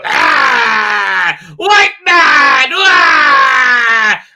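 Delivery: high, loud hammed-up villain roar; cartoon evil. Reviewer mocking the villain, loud cartoon evil roar. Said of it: "cartoon evil".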